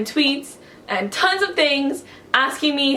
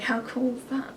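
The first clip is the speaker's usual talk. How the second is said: ordinary talk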